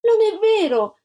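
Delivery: high, loud protest; wronged little girl shouting back; indignant child's cry. A narrator's reading. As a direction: cry out in denial, pitched right up. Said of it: "gridò Antonella".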